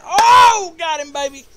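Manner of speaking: very loud, high celebratory shout; triumphant yell. Real, not acted. Streamer landing a spell pierce, triumphant yell. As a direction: explode with glee at the winning move.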